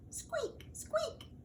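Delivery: tiny, very high, soft squeak; lantern squeak. A narrator's reading. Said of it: "went the lantern".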